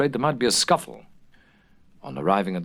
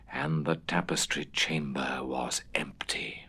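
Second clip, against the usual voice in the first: hushed, softer whisper; 8 semitones lower, 9 dB quieter, slower than usual. A narrator's reading. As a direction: breathe it out eerily. Hushed narration of empty room.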